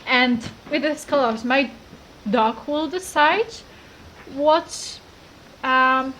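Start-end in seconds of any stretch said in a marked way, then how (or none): none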